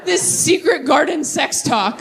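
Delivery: ordinary talk, nothing marked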